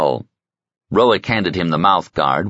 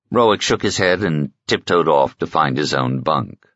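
ordinary delivery

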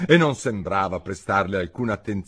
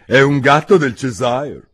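lightly voiced duchess; 5 semitones higher, 9 dB louder than usual. Duchess answers Alice curtly.

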